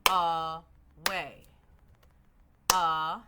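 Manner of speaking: "Away" loudly split as "A", pause, "way"; "A... way", loud and evenly spaced, twice over; clapped syllables. Shown as a demonstration. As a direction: punch out each syllable of "Away" separately. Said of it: "use that clapping technique".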